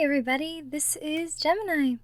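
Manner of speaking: ordinary delivery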